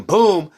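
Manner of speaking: high, loud boom; mimicked hit. Describing his own hit in the fight, loud high boom. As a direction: pop it out like a quick blow.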